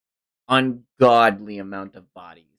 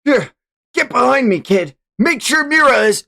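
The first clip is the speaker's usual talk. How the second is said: protective hero; 13 semitones higher, 5 dB louder than usual. Acted.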